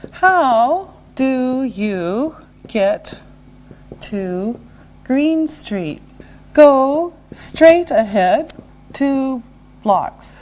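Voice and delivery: very slow, separated words; slow and deliberate. Shown as a demonstration. Teacher modelling directions for learners, slow and separated. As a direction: give learners one word at a time.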